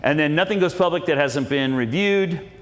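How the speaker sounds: ordinary talk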